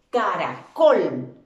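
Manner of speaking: "Caracol" very slow and loud, weight on "col"; stress on last syllable. Shown as a demonstration. Teacher showing an imaginary accent mark, "Caracol" stressed on "col". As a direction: stretch "Caracol" and hit the final "col". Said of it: "yo colocara un acento".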